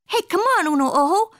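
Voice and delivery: high, urging cartoon call. A narrator's reading. Kitty boy urging uno ojo along, calling. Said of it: "called the kitty boy".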